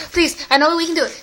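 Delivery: ordinary talk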